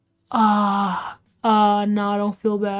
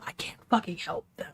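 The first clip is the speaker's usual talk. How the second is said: soft breathy whisper, much softer; 9 dB quieter than usual. Real, not acted. Streamer whispering so her sister next door won't hear. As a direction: keep it down to a whisper. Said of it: "I'm gonna have to whisper".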